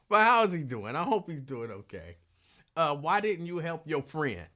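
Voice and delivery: usual speaking voice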